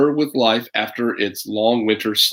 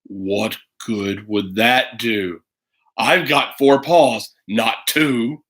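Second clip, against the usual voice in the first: low, growly greedy bear, slowing to savour it; a hungry beast gloating over its dinner; 4 semitones lower, much slower than usual. Acted. Bear scoffs at mittens.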